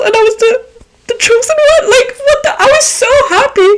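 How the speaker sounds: high-pitched giddy brag; overjoyed high voice. Real, not acted. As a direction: squeal about being chosen. Excited about being chosen.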